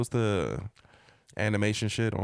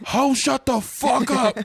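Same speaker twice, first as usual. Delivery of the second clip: very high, drawn-out hammed-up shout; mock snap to eleven; 6 dB louder, much slower than usual. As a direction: explode in an exaggerated yell. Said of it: "snapped up to".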